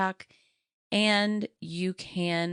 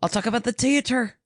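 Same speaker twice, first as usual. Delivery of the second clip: put-on Irish accent; 5 semitones higher than usual. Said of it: "in an Irish accent".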